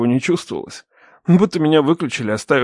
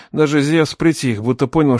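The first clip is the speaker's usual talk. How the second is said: ordinary delivery, nothing marked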